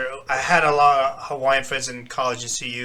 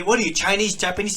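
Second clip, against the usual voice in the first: higher-pitched schoolyard taunt; mock taunting kids; 6 semitones higher, faster than usual. Kids at school asking him his background, mock taunting. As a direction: imitate nosy, teasing kids.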